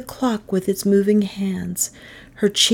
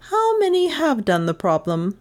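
higher-pitched classroom question; teacher asking class; 7 semitones higher than usual. Acted. Miss Todd breaks silence to the pupils, asking. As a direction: lift the pitch to address the class.

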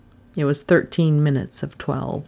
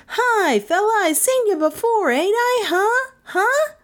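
very high, over-friendly barfly squeal; high, nagging barfly greeting; chummy barfly. A narrator's reading. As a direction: pester a stranger, voice way up high. Barfly weaves up to Trent.

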